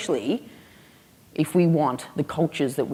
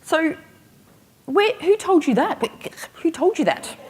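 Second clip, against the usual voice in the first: high-pitched, stammering mock panic; retold panic, voice shooting up; freaking out; 12 semitones higher than usual. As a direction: demand it like a flustered parent. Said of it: "I'm freaking out a bit".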